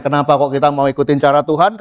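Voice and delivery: usual speaking voice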